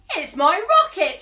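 loud, proud announcement; a child proudly showing his toy. Acted. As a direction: show off the new rocket. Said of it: "he said proudly".

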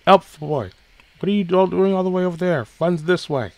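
bright, quick, high-pitched character calling out; high bubbly girl voice. Acted. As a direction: call them over, high and fast. Bubbly character calls from afar.

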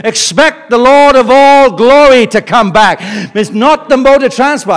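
preaching at full force. Real, not acted. Preaching climax to congregation.

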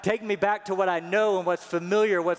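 high, loud whiny begging; whining Israelites. Acted. Preacher mimicking Israelites begging God to return them, loud and high. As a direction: plead to go back like a homesick child. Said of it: "all they wanna do".

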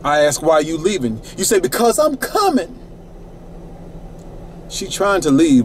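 ordinary talk, nothing marked

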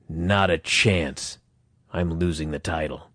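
low, grave vow. Retold or imitated. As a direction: drop it down slowly, imitating a resolute champion. Quoting champion's closing vow.

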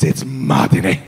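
very low mate voice; spaced-out mate. Retold or imitated. Mate hearing about the trip, very low knowing voice. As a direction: drop right down, deadpan and knowing.

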